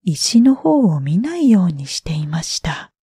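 ordinary delivery

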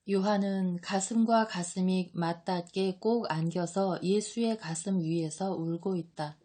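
plain reading voice

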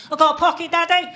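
put-on a three-year-old repeating herself proudly; toddler voice. Daughter showing off her coat pocket, in a lightly voiced toddler voice. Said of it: "following me around the house saying".

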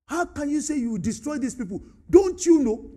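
high, indignant talking-back; cheeky rebuke to an elder; rude challenge. Retold or imitated. Moses challenging God, a touch indignant and rude. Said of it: "That was rude".

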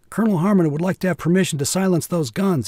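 a mild stammer, embarrassed. A narrator's reading. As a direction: push it out, embarrassed and unsure. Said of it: "he stammered".

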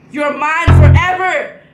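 loud sinister proclamation; menacing demon shout. Pretend. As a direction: boom it like a demon claiming souls. Scary act climax.

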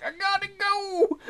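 high, slow, whiny little voice; squeaky character voice. Acted. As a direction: voice a desperate Sim who must go.

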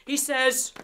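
usual speaking voice

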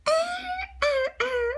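high strained croaks; croaky throat noises. A narrator's reading. Disguised kid pretending sore throat, croaking. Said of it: "did not speak".

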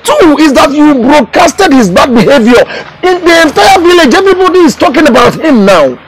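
high, exasperated scolding; loud reproach. Acted. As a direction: hammer the second fault home loudly. Elder scolds loudly.

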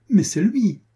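high, loud cry of recognition; excited exclamation. A narrator's reading. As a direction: exclaim it loudly, pitch leaping up. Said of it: "s'écria mademoiselle de Souvigny".

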